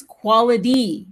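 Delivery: fast, flapped T. Shown as a demonstration. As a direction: rush "quality" so the T sounds like a D. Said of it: "if you say it fast".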